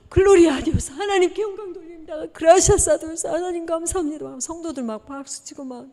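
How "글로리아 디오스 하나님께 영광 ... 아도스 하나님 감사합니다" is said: higher, louder glad shouts of praise; joyful praise. Retold or imitated. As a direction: cheer it like a moved congregation. Congregation praising God.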